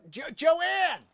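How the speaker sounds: high exasperated yell of a name; sharp, high-pitched summons; calling out. Real, not acted. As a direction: yell for her across the house. Yelling for Joanne off mic.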